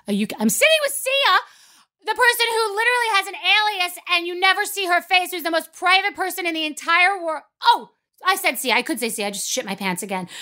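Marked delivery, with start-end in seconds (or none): 0.1-7.2 much higher, frantic disbelief; panicked disbelief. Retold or imitated. Telling the audience about the blunder, high panicked disbelief. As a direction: squeal it out in panic.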